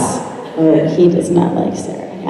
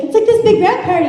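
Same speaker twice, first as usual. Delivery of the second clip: much higher, bright excitement; bubbly mentor; 11 semitones higher than usual. Retold or imitated. Sarah excitedly announcing her Atlanta trip, much higher. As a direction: sell it like the best news.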